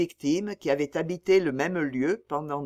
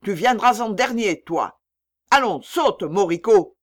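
loud, raised-pitch order; harsh command; 4 semitones higher, 8 dB louder than usual. A narrator's reading. Trader ordering the boy up to the auction, loud command. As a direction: order him up with force.

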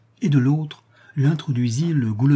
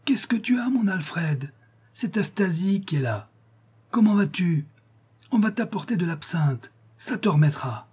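calling into his ears; 8 semitones higher than usual. A narrator's reading. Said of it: "elle lui cria aux oreilles".

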